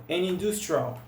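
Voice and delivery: an industrial run together as "anindustrial"; an industrial glued into one word; an industrial junto, num bloco só; linked sound block. Shown as a demonstration. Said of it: "é como se ficasse quase que isso daqui".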